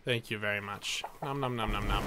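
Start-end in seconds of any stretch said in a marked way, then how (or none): 1.2-2.0 mock eating. Pretends to eat soup.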